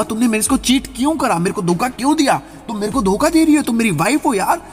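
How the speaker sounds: high, pained accusing outburst; a husband's high, heartbroken accusation of his wife; hurt accusation. Acted. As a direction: cry out that she betrayed him. Husband confronts cheating wife.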